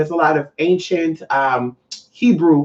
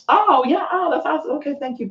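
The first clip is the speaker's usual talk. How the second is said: polite brush-off; 9 semitones higher than usual. Retold or imitated. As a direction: wrap it up with put-on niceness. Acts polite brush-off of stranger.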